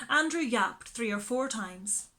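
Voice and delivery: plain reading voice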